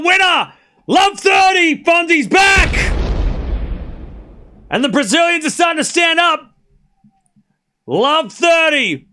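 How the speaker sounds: high, loud commentator's roar; real big-moment sports excitement; ecstatic shouted call. Real, not acted. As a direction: shout the winner and score over a roaring crowd. Celebrates a winning passing shot.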